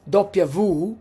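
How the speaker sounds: "doppia V" as DOP-ya voo; "doppia V" said the Italian way; "doppia V" with voo at the end. Shown as a demonstration. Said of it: "we call it double V".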